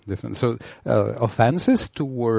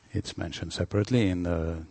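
normal talking voice

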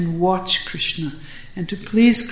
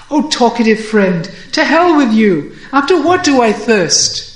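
loud, higher, fond scolding; angry yet affectionate; 4 semitones higher, 6 dB louder than usual. Acted. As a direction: snap at a friend but keep it loving. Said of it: "simultaneously angry and affectionate".

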